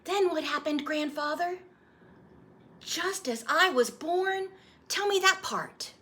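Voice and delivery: put-on high, eager child voice; a little boy pleading for the story; young boy. Boy asking his grandfather to retell his birth, eager child voice.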